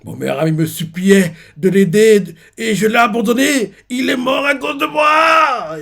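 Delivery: high, loud sobbing shout, slowing; crying out in guilt. Retold or imitated. Harald cries out that his friend died because of him. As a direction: wail the last words through tears. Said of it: "Il dit ça en pleurant".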